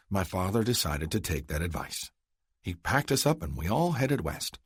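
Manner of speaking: [name], wry. Acted. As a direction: tell a sad past lightly.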